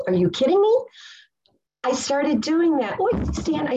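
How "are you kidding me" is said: high, loud disbelief; incredulous. Real, not acted. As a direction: burst out in amazed disbelief. Incredulous at daily letter writing.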